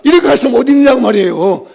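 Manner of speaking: normal talking voice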